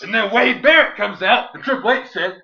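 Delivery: full-force hammed-up promo delivery; over-the-top wrestling promo. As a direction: bellow it slowly like a hyped-up wrestler.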